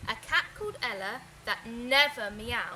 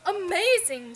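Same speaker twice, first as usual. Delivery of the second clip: loud, high gasp of wonder; amazed; 6 semitones higher, 9 dB louder than usual. Acted. Father hearing Frankie's music, amazed. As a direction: marvel out loud. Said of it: "said his father".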